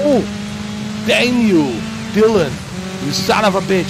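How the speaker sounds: furious shouting; shaking with anger. Real, not acted. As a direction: yell it in real rage. Rival car wrecks him in race.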